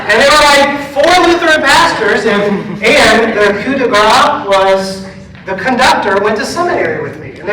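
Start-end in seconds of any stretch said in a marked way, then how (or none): 0.1-5.1 high, loud, laughing through it; excited retelling. Real, not acted. Pastor telling his congregation the surprise, excited and loud. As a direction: still bubbling over with glee. Still laughing over coincidence.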